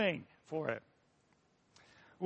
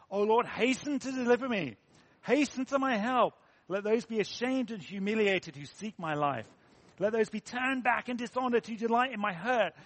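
higher, urgent scripture declamation; fervent psalm reading; 3 semitones higher, 3 dB louder than usual. Acted. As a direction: read it as an earnest plea to God. Reads Psalm seventy aloud.

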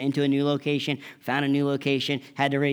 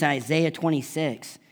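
normal talking voice

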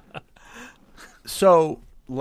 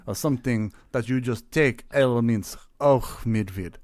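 put-on Russian accent. Player brainstorming the bear's name, Russian accent. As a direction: weigh each word in a Russian accent. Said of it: "Midvid keeps me in the accent".

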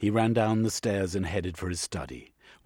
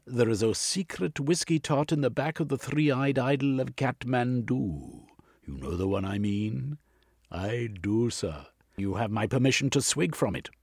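commanding gentleman; 7 semitones higher, 4 dB louder than usual. Acted.

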